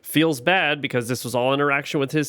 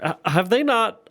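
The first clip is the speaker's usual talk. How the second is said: hammed-up disbelief, pitched well up without shouting; mock inner scream; 9 semitones higher than usual. Confused viewer screaming inwardly at the characters, mock exasperation. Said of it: "I'm literally screaming".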